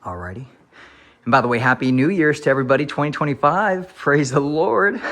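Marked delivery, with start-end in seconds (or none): 1.2-5.0 excited. Real, not acted. New Year greeting burst.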